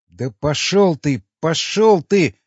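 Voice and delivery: slow, loud, rising fury; angry cursing. A narrator's reading. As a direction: throw the curse out, then escalate it. Restrained man curses his companion.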